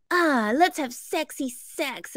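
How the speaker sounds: drawn-out, put-on sexy moan; mock seductive. Imitating a wife coming on in fights, mock seductive. Acting out her seductive line.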